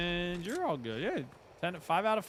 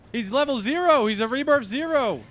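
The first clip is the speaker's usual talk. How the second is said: excited teasing; 8 semitones higher, 9 dB louder than usual. Real, not acted. Teasing a low-level player.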